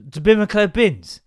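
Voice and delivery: "To bin my clear bins" slurred loudly into one blob; garbled question. Retold or imitated. Baffled wife repeats son's bin question, garbled. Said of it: "enunciating a bit better".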